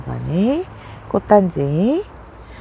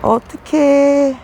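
dismayed; 8 semitones higher, 4 dB louder, much slower than usual. Real, not acted. Plant lost to rot.

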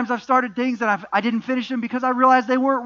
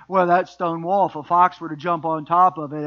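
put-on country accent; 5 semitones lower than usual. Preacher voices the sidekick mocking the wall, country accent. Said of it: "gave him a country accent".

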